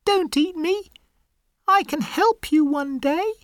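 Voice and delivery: very high, tiny pleading mouse voice; very high frightened mouse begging; pleading little mouse. Acted. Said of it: "The mouse is scared".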